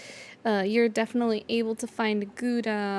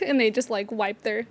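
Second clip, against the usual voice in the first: ordinary delivery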